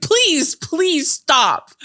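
high pleading whine; mock pleading. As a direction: beg to stop, hammed-up. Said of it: "he's like".

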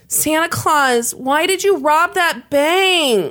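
put-on high, slow, childlike quaver; little boy, shaky voice. A little boy at church asking Santa about the robbery, shaky and high. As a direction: plead like a frightened small boy. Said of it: "cried out in a shaky voice".

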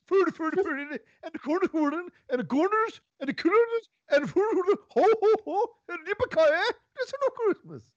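mock Swedish babble. Mock Swedish gibberish rant.

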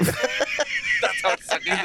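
high, very slow, put-on voice; mock announcer voice. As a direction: drawl it out, high and silly. Mock verdict on the caw.

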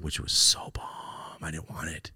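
slow breathy whisper. Real, not acted. Whispered aside.

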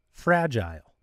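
"fragile" with the second syllable like "aisle", low and soft; long-I pronunciation. Real, not acted. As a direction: say "fragile" to rhyme with "tile". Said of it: "Are you a fragile or fragile?".

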